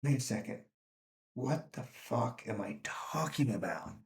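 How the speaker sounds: half-whispered, stunned to yourself; quiet, stunned inner thought; sudden realization. Retold or imitated. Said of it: "It's like".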